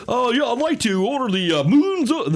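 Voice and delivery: high, hammed-up broadcaster voice; announcer ordering food. Announcer ordering at a diner, over-the-top announcer voice. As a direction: order breakfast like calling a title fight. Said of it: "imagine he's just ordering".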